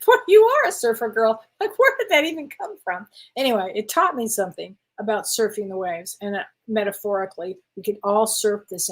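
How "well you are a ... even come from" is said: delighted reply. Retold or imitated.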